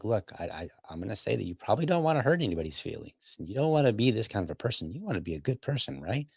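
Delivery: mock coaxing in a soft undertone; buttering up. Man flattering the woman he is debating, retold as soft buttering up. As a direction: softly imitate someone buttering her up. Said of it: "buttering her up".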